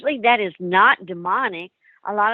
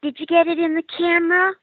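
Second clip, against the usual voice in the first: put-on high little girl voice asking; the child ghost asking a question; eerie child voice asking; 9 semitones higher, faster than usual. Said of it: "the little girl said".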